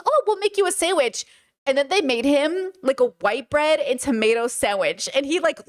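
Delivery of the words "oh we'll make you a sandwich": cheery hostess. Retold or imitated. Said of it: "And then she goes".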